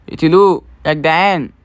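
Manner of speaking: loud urgent call to kill it; shouting to team. Real, not acted. Gamer yelling at teammates to kill it, loud. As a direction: yell at the squad to attack.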